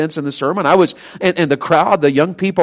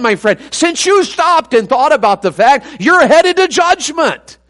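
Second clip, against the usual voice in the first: high, insistent sermon warning; preaching; 5 semitones higher, 3 dB louder than usual. Real, not acted. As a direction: press the congregation hard with each clause. Preaches warning at full force.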